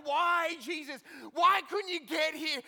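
shouted high, pleading with Jesus; anguished cry. Acted. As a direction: cry out in grief at a late friend. Martha crying out to Jesus.

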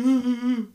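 loud, helpless uh, uh, uh; a mocking, aimless groan; mock needy groan. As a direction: moan like someone lost and empty. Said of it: "walking around going".

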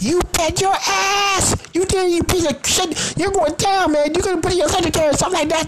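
very high mock yelling; acted angry rant. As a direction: rail at the suspect in a high-pitched imitation. Acting out Munch's angry interrogation rant.

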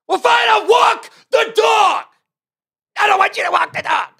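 high, loud yelling back and forth; angry shout. Retold or imitated. Yelling at the woman about walking the dog, high and furious. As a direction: shout it, fed up.

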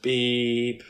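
long held imitation bleep; censor beep. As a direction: mimic a censor tone, drawn out. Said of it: "it has been censored".

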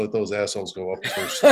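a mock gruff dad, laughing; father quoted laughing. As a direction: chuckle while quoting dad. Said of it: "that's the way he talked".

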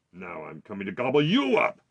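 loud troll threat; menacing troll. A narrator's reading. Troll threatening to eat the second goat, loud and menacing. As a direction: boom it out, hungry troll.

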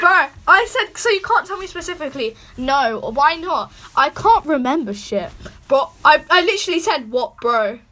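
re-read it with loud, indignant emphasis; exasperated retelling. Real, not acted. Repeats exchange exasperatedly after listener laughs.

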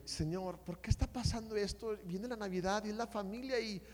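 soft, subdued plea; retold troubled prayer. Praying over a family fight before Christmas, soft lament. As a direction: quietly ask the Lord why, re-enacted.

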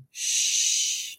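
long hushing "shhh"; shushing sound. Retold or imitated. Mother recounting the mysterious noise she heard, imitated shush. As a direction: imitate someone shushing from the dark. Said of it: "alguém fazendo assim".

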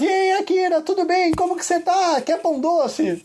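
high, bright mock-cheery fan voice; cheerful fans. Viewers greeting Akira in the live chat, high mock-cheery. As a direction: chirp it like eager viewers greeting a friend. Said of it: "E as pessoas".

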